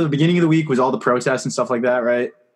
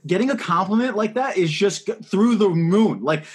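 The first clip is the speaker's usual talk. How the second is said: usual speaking voice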